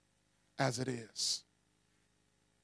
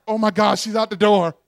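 retold panic; 9 dB louder than usual. Hiding from stalker, panic.

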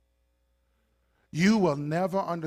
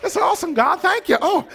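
high, loud thrilled gushing; mock delight; 7 semitones higher, 7 dB louder than usual. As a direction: gush over the new house. Delighted in new house.

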